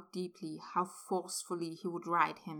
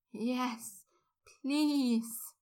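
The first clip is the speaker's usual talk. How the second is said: higher moaned plea; moaning; 5 semitones higher than usual. A narrator's reading. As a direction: moan the please out. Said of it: "Draco moaned".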